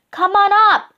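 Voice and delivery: high, loud call; excited high holler; shouting. A narrator's reading. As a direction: shout down for him to climb. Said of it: "Annie shouted".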